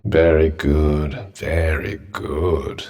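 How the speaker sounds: very low, slow drawl; dry approval. Acted. Companion drily praising the cat charmer, slow low drawl. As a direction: draw each word out low and unimpressed.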